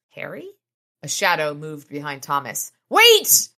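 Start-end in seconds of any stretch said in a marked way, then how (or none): 0.1-0.5 much softer, rising; startled question. Acted. Thomas suddenly recognizing his brother, soft and startled. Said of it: "his expression startled". 2.9-3.5 very high, loud cry; scream. Acted. Harry warning Thomas of the shadow, screamed high and loud. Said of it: "I screamed".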